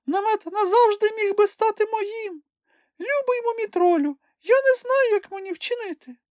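a fretting little creature torn about the tent; whiny Sniff. A narrator's reading. Sniff lamenting to Moomintroll. (Чмих жалібно пищить до мумітроля)

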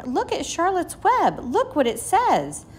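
high and loud, slowing at the end; excited cry. A narrator's reading. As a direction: keep calling out in delight. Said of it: "cried Fern".

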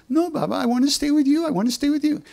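high pleading cry of a devoted baba; pleading sadhu. Retold or imitated. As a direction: beg him not to leave you behind. Baba insists on staying.

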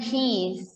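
"cheese" said as she's, with a final hiss; one syllable, "cheese" as she's; contracted form. Shown as a demonstration. Teacher showing students the short form of she has, contracted form. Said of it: "She has จะเป็น".

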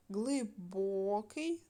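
глибокий drawn out slowly on БО; slow глиБОкий, stressed syllable stretched; stress on middle syllable. Shown as a demonstration. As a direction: lean long on the о in глибокий. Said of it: "поставте наголос".

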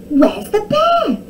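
high, loud, drawn-out alarm; gasped question. A narrator's reading. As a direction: demand urgently as an alarmed crowd. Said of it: "grasped the townsfolk".

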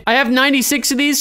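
ordinary delivery

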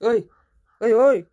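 loud, high hey hey; shouting to wake. Acted. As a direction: yell to rouse him. Constable wakes prisoner.

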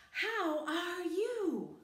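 high, slow, exaggerated question; teacher voice. Shown as a demonstration. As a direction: stretch it out for a beginner. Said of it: "is I will say".

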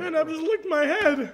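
high, loud squawk; loud disbelieving complaint; outraged shout. Acted. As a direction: yell it in mock horror. Licked by cat character.

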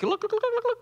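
high burbling "luh-luh-luh" noise; cartoon gobbling noise for a slithering snake; playful high-pitched tongue babble; imitated snake slither noise. Imitates snake sliding down the tree.

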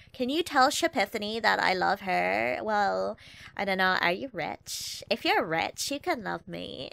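put-on drawling Valley Girl accent, put upon; Valley Girl whine with stretched words; Shipiphany. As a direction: play a bored, entitled Valley Girl. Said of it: "bring out Shipiphany for the, for the Valley Girl accents".

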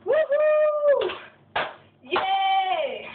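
high, drawn-out whoop of victory; triumphant cheer. Real, not acted. Cheering after finishing the beer.

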